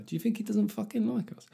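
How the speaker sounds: high, hushed mock worry; high and soft, as if wounded by a mate; mock disappointed. Let-down mate fretting to the others, mock disappointed. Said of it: "everyone would be disappointed".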